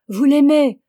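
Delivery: high, loud cry of disbelief; shouted question. Acted. The old woman cries out at Augustin, loud and high. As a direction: cry it out in alarm. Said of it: "Cria la chavoche".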